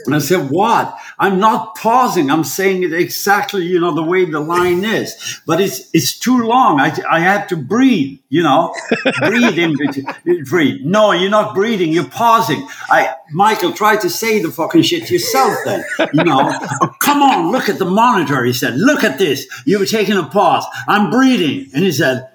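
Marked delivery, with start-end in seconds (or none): 0.5-10.8 higher, animated defensive retort; acted-out indignant arguing; indignant protest. Acts out his protest to director. 10.8-18.4 high-pitched, exasperated bickering; mock-angry back and forth; heated retold argument. Retells the shouting match with director. 18.8-21.7 retold bickering. Retells the quarrel at the monitor.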